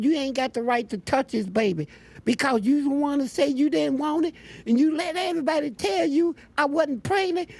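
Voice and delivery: high-pitched mimicked scolding; indignant accuser. Inmate faking pregnancy warns storyteller off the baby, indignant.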